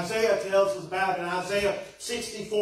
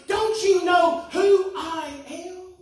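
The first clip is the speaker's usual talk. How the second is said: slow, loud, offended demand; outraged that nobody knows him; indignant; 9 semitones higher, 6 dB louder, slower than usual. A narrator's reading. Donkey indignantly demanding the crowd recognise him.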